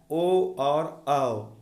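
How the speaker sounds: ओ and औ stretched out very slowly, each vowel held long; slow vowel demo. Shown as a demonstration. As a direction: draw out ओ and औ slowly so students can copy them. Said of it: "ये इसको practical करिएगा". (ओ और औ को बहुत धीरे, खींचकर बोलना)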